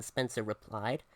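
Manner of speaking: usual speaking voice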